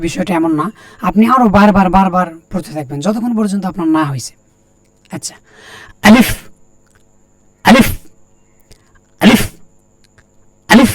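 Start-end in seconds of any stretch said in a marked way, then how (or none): 6.1-10.9 each letter name said on its own, with pauses; teacher's Arabic letter drill. Shown as a demonstration. Teacher drilling students on the letter alif. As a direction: model the letter clearly for students to repeat. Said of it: "এভাবে বলেন".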